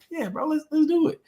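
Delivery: put-on high, eager yes to a kid. Replying to a young esports kid, high and eager. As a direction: jump in high and keen. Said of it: "I'm like".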